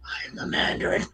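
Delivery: breathy retold whisper, very soft. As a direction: hiss it like a secret threat in the ear. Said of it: "And then he whispers".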